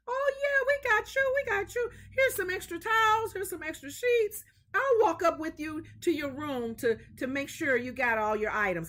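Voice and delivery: high, loud eager helper voice; cheerful hotel lady. Retold or imitated. Hotel worker eagerly reassuring a guest, high and loud. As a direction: play the eager housekeeper.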